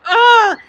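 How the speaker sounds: a loud, high choked groan of near tears; frustrated groan. Retold or imitated. Exhausted saleswoman nearly breaking down in the elevator, groaning. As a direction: let out a strangled cry of frustration. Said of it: "about to just bawl".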